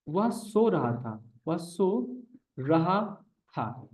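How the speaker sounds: slow, with a pause before रहा था; slow dictation. Shown as a demonstration. Teacher dictating a sentence to the class, slow. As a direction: lay out वह सो रहा था piece by piece. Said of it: "teacher dictates sentence slowly".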